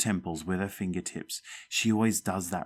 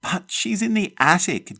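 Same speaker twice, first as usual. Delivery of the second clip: high, louder alarmed cry; cried out; 11 semitones higher, 5 dB louder than usual. Acted. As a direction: cry it out pointing upward in fear. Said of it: "Jeff cried".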